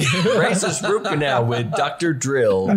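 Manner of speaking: slow, drawn-out announcer voice. Pretend. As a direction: announce it like a show title. Announcing a fake podcast.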